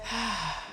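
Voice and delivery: long breathy out-breath; heavy exhale. Real, not acted. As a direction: breathe out hard to recover. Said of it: "Catch your breath".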